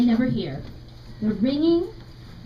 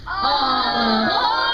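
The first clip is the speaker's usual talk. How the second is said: slow drawn-out shout; train conductor calling; 3 dB louder, much slower than usual. Shown as a demonstration. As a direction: call everyone aboard, slowly. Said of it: "can you all repeat what the conductor yelled".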